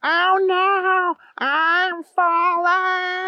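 very high, long drawn-out wail; yelling while falling. A narrator's reading. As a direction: stretch the cry out as you plummet. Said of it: "he was yelling".

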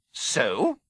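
wife snapping at her husband, curt; snapped. Acted. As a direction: bite the word off. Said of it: "snapped Missus Dursley".

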